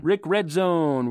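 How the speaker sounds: loud echo. Real, not acted. Host echoing cohost's handle, ring-announcer style.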